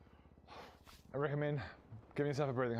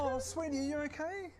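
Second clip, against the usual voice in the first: put-on child voice; 11 semitones higher than usual. Child voice answering.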